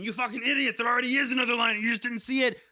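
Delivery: high-pitched mock-outraged internet commenter; mock a screaming commenter in a high, strained put-on voice. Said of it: "Atraxa players in the comments right now that are screaming".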